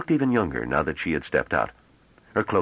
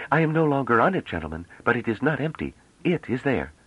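put-on a young woman's higher voice, teasing; playful young woman; 8 semitones higher than usual.

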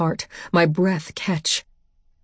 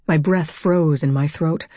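plain reading voice

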